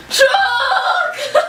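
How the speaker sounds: high shrieked shock. Pretend. Fan girl squealing mock shock at meeting the band.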